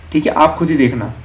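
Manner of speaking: ordinary delivery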